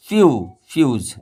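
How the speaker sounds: फ्यू then फ्यूज, syllable first, then the whole word; model pronunciation. Shown as a demonstration. Teacher builds फ्यूज syllable by syllable for pupils. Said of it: "ऐसे".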